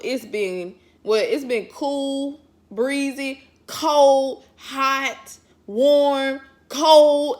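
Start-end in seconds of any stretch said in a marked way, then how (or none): 1.2-7.4 exasperated listing. Real, not acted. Listing Texas weather, exasperated.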